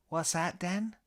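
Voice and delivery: put-on high British-accented question; Eric Idle. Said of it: "impression of Idle's Cambridge-tinged inflections".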